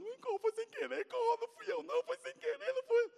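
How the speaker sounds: high, squeaky crying excuse; mock-tearful whimpering plea; whiny pleading. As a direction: beg like a scared kid caught out. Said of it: "ele já foi logo chorando".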